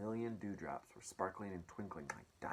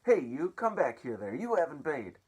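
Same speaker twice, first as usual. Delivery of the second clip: angry yell; 7 semitones higher, 11 dB louder than usual. Acted. Aunt yells at sneaking customer.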